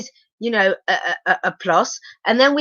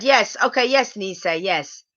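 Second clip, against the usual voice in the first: ordinary talk, nothing marked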